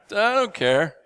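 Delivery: high, loud shrug of a line; careless partner laughing. Retold or imitated. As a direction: toss it off like the partner. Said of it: "I think he said".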